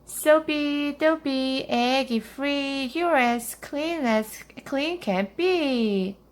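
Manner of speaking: high, slow incantation; magic spell. A narrator's reading. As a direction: chant it like a little witch casting a spell. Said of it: "she said a magic spell".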